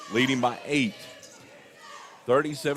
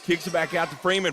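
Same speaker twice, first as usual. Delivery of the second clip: usual speaking voice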